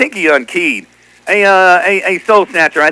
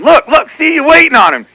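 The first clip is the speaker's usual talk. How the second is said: retold shout; 9 semitones higher than usual. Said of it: "He said".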